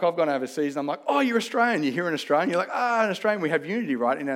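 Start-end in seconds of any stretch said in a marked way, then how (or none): 1.1-1.8 higher, louder mock-excited greeting; delighted recognition. Acts out meeting a countryman abroad. 2.7-3.4 lightly acted, higher-pitched happy surprise; raised pitch, playful exclamation of recognition; mock delighted recognition. Acts out recognising a fellow Australian.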